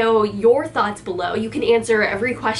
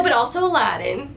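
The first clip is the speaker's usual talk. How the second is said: ordinary talk, nothing marked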